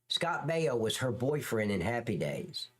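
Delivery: ordinary talk, nothing marked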